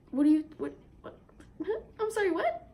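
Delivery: high, incredulous "what?" climbing up; stunned disbelief. Retold or imitated. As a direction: sputter it out in total disbelief. Stunned reply after near drowning.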